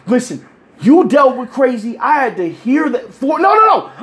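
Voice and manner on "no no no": high, loud protest. Real, not acted. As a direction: shut him down loudly. Cutting off friend loudly.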